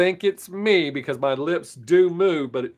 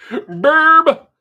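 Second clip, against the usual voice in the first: loud mock burp; 13 semitones higher, 10 dB louder than usual. Makes a burp sound.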